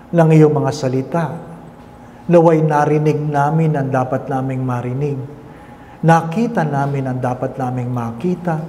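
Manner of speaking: soft, measured prayer voice; quiet devout prayer; closing prayer. Acted. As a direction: pray over the congregation gently.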